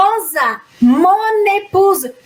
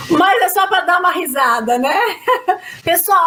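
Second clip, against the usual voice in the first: ordinary talk, nothing marked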